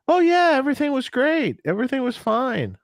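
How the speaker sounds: very high, breezy fake reassurance; insincere sound guy. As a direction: brush them off with a chirpy lie. Sound guy answering the band.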